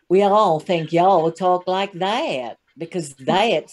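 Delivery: slow cowboy-movie American drawl; a Brit's exaggerated American twang, drawn out; put-on American accent. Shown as a demonstration. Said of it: "that's the accent we can all do".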